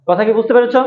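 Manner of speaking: usual speaking voice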